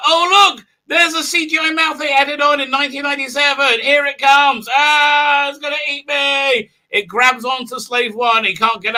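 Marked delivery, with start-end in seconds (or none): 0.1-6.7 high, loud, hammed-up fright; mock panic. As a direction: squeal like the monster is coming for you.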